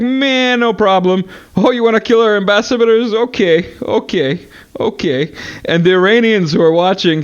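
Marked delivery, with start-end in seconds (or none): 0.0-5.3 high, sarcastic shrug; mock Russians. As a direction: brush it off mockingly. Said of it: "the Russians were like".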